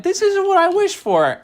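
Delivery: very high, loud mock wail; protesting girl character. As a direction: squeal it in comic dismay. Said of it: "And she's like".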